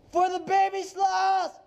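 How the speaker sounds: slow rising rallying shout; battle cry. Real, not acted. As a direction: yell it like a charge.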